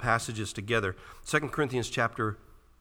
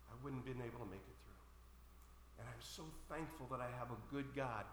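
hushed testimony; 18 dB quieter than usual. Acted. Preacher voicing believers thankful they made it through, hushed testimony. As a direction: drop very soft, humbly thankful.

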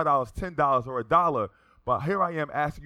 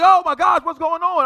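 loud, high-pitched shocked outburst; acted shouting in alarm; startled crowd; 13 dB louder than usual. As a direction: cry out like an alarmed passenger. Startled passengers after the scream.

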